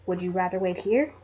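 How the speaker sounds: soft, low, kind question; gentle. Acted. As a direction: reassure her softly. Nancy reassures trembling Effie.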